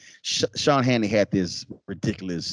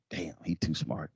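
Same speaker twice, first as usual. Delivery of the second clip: muttered aside; 6 semitones lower, 10 dB quieter than usual. Retold or imitated. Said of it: "He's like".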